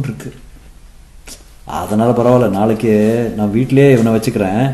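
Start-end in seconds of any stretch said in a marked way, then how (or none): none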